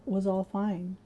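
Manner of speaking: ordinary talk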